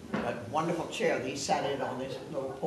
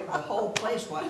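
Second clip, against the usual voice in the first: ordinary delivery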